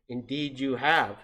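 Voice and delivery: slow, drawn-out owl pronouncement; mysterious owl voice. Shown as a demonstration. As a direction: intone it slowly as a grand owl. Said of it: "said a voice like this".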